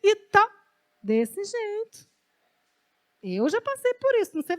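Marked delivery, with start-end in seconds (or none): 0.0-0.5 high, louder, whining plea to the Lord; whiny spoiled child. Retold or imitated. Praying to the Lord like a spoiled child, high whiny plea. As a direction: whimper it like a pampered kid begging. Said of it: "chorando assim".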